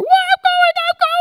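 very high loud insistent squeal; squealing daughter. Retold or imitated. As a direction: squeal it like a determined kid.

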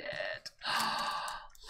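sharp gasp of surprise; quick breath in at a lovely surprise; delighted gasp. Real, not acted. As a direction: gasp as the package opens. Opening happy mail.

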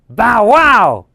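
playful high bow wow, loud; dog bark. Acted. Teacher barking like the dog in the story. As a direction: bark it out like a puppy. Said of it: "barks Brown".

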